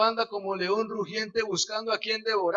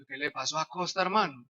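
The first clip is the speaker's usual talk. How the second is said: low, soft, concerned question; hushed worried asking; gentle concern; 7 semitones lower, 9 dB quieter than usual. Retold or imitated. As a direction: ask softly what happened. Soft concern toward crying friend.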